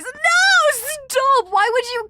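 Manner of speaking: high, loud laughing protest; shrieking no; mock protest. Real, not acted. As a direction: cry out in playful horror. Reacting to chat teasing.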